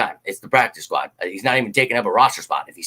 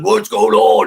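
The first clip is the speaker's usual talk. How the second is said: higher, louder mock fan asking what's up; impatient fans; 7 semitones higher, 6 dB louder, slower than usual. Imitating fans who demand the news, raised pushy voice. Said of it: "you guys are like".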